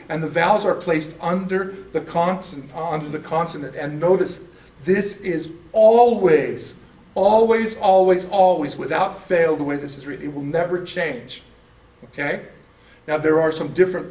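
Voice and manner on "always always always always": ALWAYS drawn out loud and raised, each time; long, forceful stress on each ALWAYS; emphatic repetition. Real, not acted. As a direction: hammer home every ALWAYS, louder and higher. Stressing an absolute rule.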